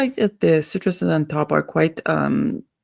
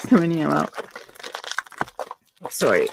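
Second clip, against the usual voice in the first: usual speaking voice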